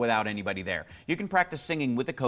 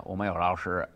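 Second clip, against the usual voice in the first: Beijing Mandarin accent; 6 semitones lower than usual. Shown as a demonstration. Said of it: "speaks Mandarin on the air".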